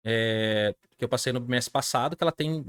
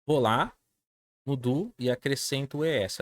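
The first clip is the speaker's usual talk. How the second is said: normal talking voice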